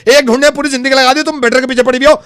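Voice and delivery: shouting, fed up; loud frustrated outburst at a friend; loud exasperated rant. Acted. Heated argument about deserving better.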